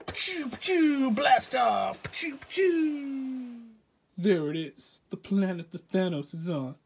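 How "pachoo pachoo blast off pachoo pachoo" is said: high, airy pachoo blasts; rocket blast noises. Pretend. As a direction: spit out playful rocket sounds. Rocket launch sound effects.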